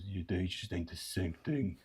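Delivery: a lightly voiced mumbling sidekick; Pum. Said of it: "Pum goes".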